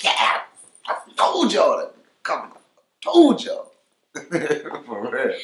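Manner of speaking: loud, high excited yell; hyped fan reaction. Retold or imitated. Fans hearing the rapper's new track, hyped high yell. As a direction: crow it like a thrilled fan. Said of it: "they like".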